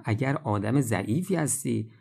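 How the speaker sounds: ordinary delivery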